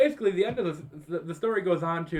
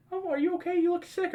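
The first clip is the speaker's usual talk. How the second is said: mom's voice. Retold or imitated. Mom waking her hungover son by phone, high and sweet.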